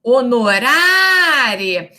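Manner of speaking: Honorare, drawn out very slowly syllable by syllable; spelled-out Latin word. Shown as a demonstration. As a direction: stretch every syllable of Honorare like a dictation. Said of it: "a vogal tônica é o A". (Honorare bem devagar, sílaba por sílaba)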